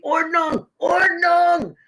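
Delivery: loud barked Ordnung, high; mock German yell. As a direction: shout it like a German rule-lover. Yelling German word Ordnung.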